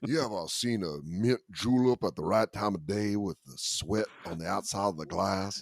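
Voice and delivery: put-on wax lyrical in a Southern accent; mock Southern gentleman, savoring it; Foghorn Leghorn. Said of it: "gone full foghorn leghorn".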